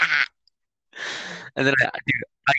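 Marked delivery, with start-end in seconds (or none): 0.0-0.2 startled yelp. Retold or imitated. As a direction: yelp in fright. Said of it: "He was like".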